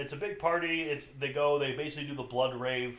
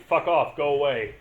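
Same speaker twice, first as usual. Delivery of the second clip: loud mock brush-off; punchy, louder mock dismissal; acted rejection; 9 dB louder than usual. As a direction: shout them away in a retold scene. Acting out rejection from the film.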